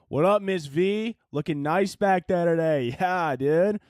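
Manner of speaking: a frat boy playing cop, full of himself; frat bro officer. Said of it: "let's do a little skit here".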